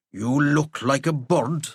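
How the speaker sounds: put-on slow old man's Irish Kerry lilt; rambling old Irishman from Kerry; thick Kerry accent. A narrator's reading. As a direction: voice an old Kerryman, taking his time. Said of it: "in a thick Kerry accent".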